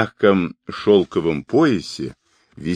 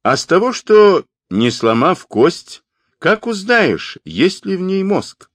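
teasing; 11 semitones higher, 3 dB louder than usual. A narrator's reading. Khulan taunts the khan.

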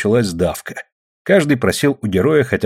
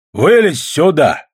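slow, raised beckoning call; calling request; 6 semitones higher, 4 dB louder, slower than usual. A narrator's reading. Golovanov asks Ksenia up, calling request. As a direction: draw it out to invite her up. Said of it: "попросил Голованов".

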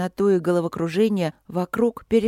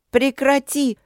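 high, louder angry cry; raised, furious outcry; angry shout; 7 semitones higher, 4 dB louder, slower than usual. A narrator's reading. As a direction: shout it angrily to make him stop. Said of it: "закричала я зло".

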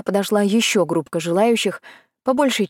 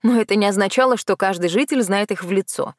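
ordinary delivery, nothing marked

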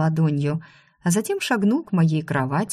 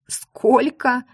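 shocked; 7 semitones higher, 4 dB louder than usual. A narrator's reading. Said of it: "Я поперхнулась".

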